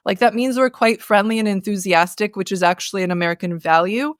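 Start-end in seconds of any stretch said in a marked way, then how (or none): none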